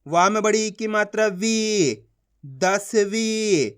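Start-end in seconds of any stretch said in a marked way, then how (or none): 1.3-2.0 वी with a long, held ee; teacher's drawn-out वी, falling at the end; stretched long ee. Shown as a demonstration. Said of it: "खींच के पढ़ा जाता है". 2.4-3.7 दसवीं very slowly, last vowel held; slow teacher's sounding-out of दसवीं; stretched long ee. Shown as a demonstration. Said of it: "खींच के पढ़ा जाता है".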